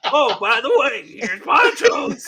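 high, loud, slow mocking voice through laughter; mocking imitation. Host mocking his co-host's plug, high and laughing. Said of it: "And then he goes".

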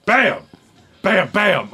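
sudden high-pitched bangs, spaced apart; vocal crash effect; imitated banging. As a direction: punch out each bang after a pause. Imitates banging heard upstairs.

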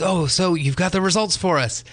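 chirpy host voice climbing high on the question; eager host. Retold or imitated. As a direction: play the upbeat emcee asking for results. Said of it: "the host says".

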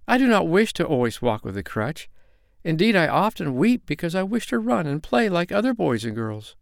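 put-on high, plaintive little girl; tearful. A narrator's reading. Girl confesses her wish to run, through tears. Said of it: "through her tears".

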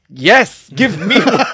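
high, loud, eager acted acceptance; eager yes. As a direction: jump at the offer loudly. Eagerly accepts an offered Valium.

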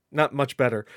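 ordinary delivery

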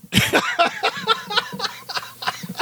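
squealing, rising laugh; laughing at the joke, shrill and rising; high laugh. Real, not acted. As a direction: crack up high. Laughing at Whale Wars.

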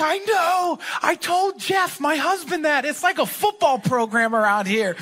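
a bright eager staff member; imitating Sharon. Pastor mimicking Sharon's eager agreement, pitched high. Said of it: "She's like".

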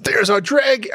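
high fake Australian accent; attempted Cockney gone Australian. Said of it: "I'd always go Australian".

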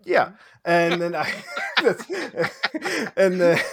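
high, loud, breaking up with laughter; laughing. Real, not acted. Player teased about making fun day two, laughing. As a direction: struggle to talk through laughter.

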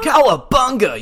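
high, loud turtle battle cry; Ninja Turtles voice. Acted. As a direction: yell it like a cartoon Ninja Turtle. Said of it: "You just talk like Ninja Turtles the whole time".

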